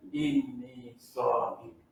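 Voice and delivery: very slow, low, soft reply; hushed, drawn-out self-control; calm restraint. Shown as a demonstration. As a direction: answer provocation slowly and quietly. Models calm answer to provocation.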